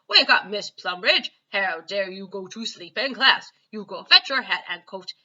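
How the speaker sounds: loud angry bark at the teacher; shouting head teacher. A narrator's reading. Head teacher shouting at the sleeping teacher, loud and outraged. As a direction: roar it like an outraged headmaster. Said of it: "and he shouts".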